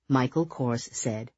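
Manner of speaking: ordinary delivery, nothing marked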